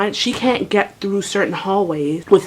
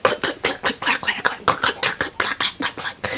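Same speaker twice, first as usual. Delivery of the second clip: whispered rapid wheelchair clatter; clicking and clacking; 7 dB quieter than usual. Retold or imitated. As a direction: imitate a wheelchair knocking along in whispers. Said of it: "I hear the clicking and clacking".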